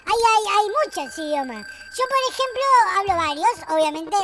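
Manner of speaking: ordinary talk, nothing marked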